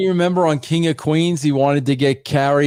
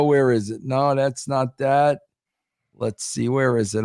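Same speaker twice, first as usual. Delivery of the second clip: normal talking voice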